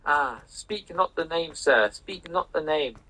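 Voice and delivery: moaning plea. Acted.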